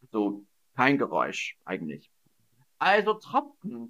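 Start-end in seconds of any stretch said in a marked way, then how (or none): none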